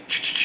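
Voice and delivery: shake-shake rattle noise, all breath; voiceless "ch-ch-ch" shaker sound; imitated Lego rattle. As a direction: mimic bricks rattling in a box. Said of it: "Does that sound like Lego".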